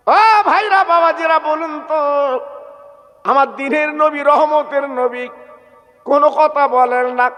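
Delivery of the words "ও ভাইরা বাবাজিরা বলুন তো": calling out. Acted. Preacher appealing to his brothers in the congregation, calling out. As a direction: rouse the crowd with a chanted call.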